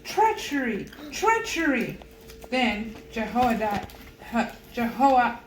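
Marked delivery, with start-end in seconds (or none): 0.0-2.0 understated cry of Treachery, second one higher; a queen's outraged cry, read lightly; lightly voiced alarm, rising on the repeat; mild read yell. A narrator's reading.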